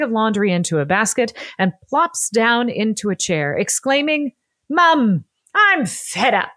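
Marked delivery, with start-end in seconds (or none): 4.7-6.5 self-admittedly bad Cockney accent, higher and louder; a cheeky young Cockney girl whining at her mum in an overdone accent. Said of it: "Apologies for my terrible Cockney accent".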